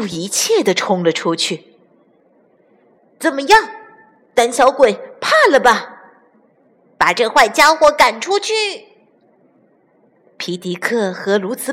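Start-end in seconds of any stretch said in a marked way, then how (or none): 3.2-8.5 taunting challenge. A narrator's reading. Roosters taunt and chase the weasel.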